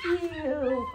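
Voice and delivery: ordinary delivery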